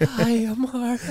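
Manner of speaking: high, slow, drawn-out quiver; badly faked nervous wobble on a voice note; mock quivering voice. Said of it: "the quiver in their voice".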